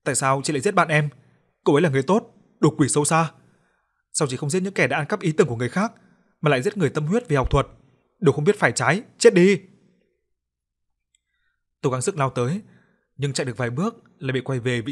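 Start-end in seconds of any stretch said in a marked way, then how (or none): 0.0-9.6 higher, louder accusing scream, exploding on the last words; furious screaming. Acted. Narrator screams at the ghost Trúc Loan who killed Nghi, furious. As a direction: hurl the blame at the killer ghost. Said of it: "Tôi thét lên".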